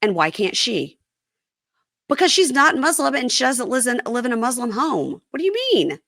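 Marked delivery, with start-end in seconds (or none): 2.1-6.0 high-pitched incredulous rebuttal, leaping higher at the end. Acted. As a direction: push the pitch up in exasperated disbelief. Host rebuts the husband, incredulous.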